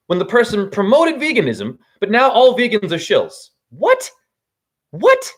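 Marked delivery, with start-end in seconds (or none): 3.7-5.3 sharply rising, much higher double what; disbelieving yelp, pitched way up; incredulous what. Real, not acted. Incredulous outburst at vegan shills.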